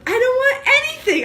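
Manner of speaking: loud fed-up outburst; exasperated. Real, not acted. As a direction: throw up your hands at it. Exasperated about kids' questions.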